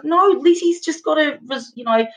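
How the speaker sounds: excited mum. Retold or imitated. Retelling Mum excited about results.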